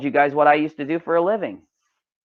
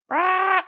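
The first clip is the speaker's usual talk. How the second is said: high silly "rah" cry; song-title squawk. Pretend. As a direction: blurt a high, raspless animal-like noise. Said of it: "the name of that one was Rah!".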